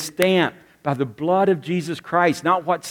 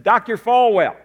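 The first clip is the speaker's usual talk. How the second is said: calling out a bit higher and louder; reporter calling out; 6 semitones higher, 5 dB louder than usual. Retold or imitated. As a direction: get his attention across the room. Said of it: "stood up and said".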